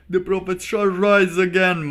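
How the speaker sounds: high, loud hammed-up prophecy; shouted mock sermon line; mock preacher proclamation. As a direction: bellow it like a revival preacher.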